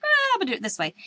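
a hammed-up carefree teacher shrugging off the rules; careless mock teacher. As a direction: toss it off like you'd do it any old way. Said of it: "be like".

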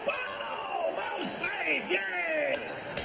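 high, shouted burst of triumph; excited cheer. Real, not acted. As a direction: cheer the keeper's save at the screen. Cheering a save on TV.

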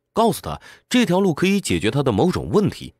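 normal talking voice

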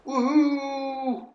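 high whooping cheer; drawn-out high woohoo; celebrating. Real, not acted. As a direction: whoop with delight. Celebrates getting passage in game.

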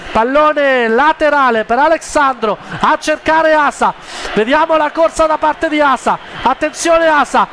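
ordinary talk, nothing marked

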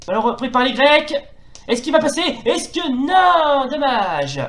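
lively football play-by-play; french sports commentary. Acted. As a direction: call the match live, rising with each attack. (commentaire sportif à la télé)

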